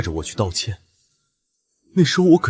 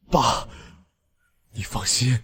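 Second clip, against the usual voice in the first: normal talking voice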